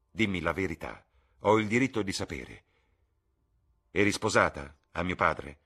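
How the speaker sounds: loud, insistent, angry questioning; angry demand. Acted. Son demanding the truth from his mother, angrily. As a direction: press her hard for the truth.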